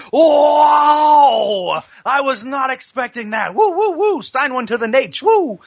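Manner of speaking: huge shouted whoa, then high whooping; wrestler's over-the-top shocked holler; Ric Flair impression. Host imitating wrestler seeing nude pictures, shocked shout and whoops. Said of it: "So he goes".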